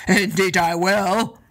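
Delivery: slow, lightly voiced giant agreeing; a hint of a lumbering giant; giant's voice. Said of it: "agreed Ben and Donner".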